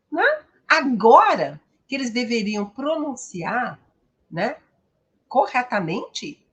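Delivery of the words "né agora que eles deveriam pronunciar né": ordinary talk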